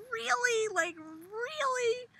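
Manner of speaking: high, slow disbelief; incredulous. Real, not acted. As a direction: stretch it in total disbelief.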